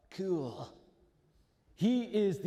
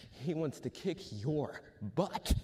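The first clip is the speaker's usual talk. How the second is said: usual speaking voice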